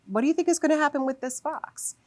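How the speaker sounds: put-on high, wide-eyed question to a child; curious storytime question. Shown as a demonstration. Teacher modelling a read-aloud question to a child, eager and high. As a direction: ask it like a thrilled storyteller. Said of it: "make predictions about the story inside".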